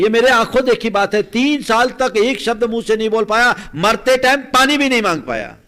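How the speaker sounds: loud, high-pitched testimony; full-force preaching. Acted. Preacher testifying to what he saw, forceful and loud. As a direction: proclaim it as a dire warning.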